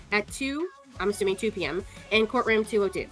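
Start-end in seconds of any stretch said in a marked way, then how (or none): none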